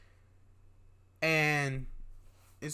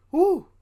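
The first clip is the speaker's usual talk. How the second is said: startled whoop; 12 semitones higher, 9 dB louder than usual. Real, not acted. Startled by a shadow in the room.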